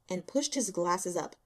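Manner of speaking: usual speaking voice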